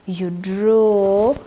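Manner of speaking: normal talking voice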